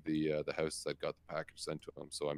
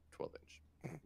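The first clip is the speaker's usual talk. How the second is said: breathy aside whisper; 11 semitones higher, 13 dB quieter than usual. Pretend. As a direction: drop to a sly whisper. Mock aside after measuring out box size.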